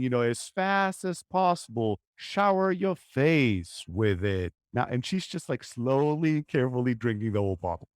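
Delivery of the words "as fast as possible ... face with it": slow, relaxed, unhurried; slow calm delivery. Shown as a demonstration. Uncle calmly telling niece to drink fast, slowly. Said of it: "I'm saying".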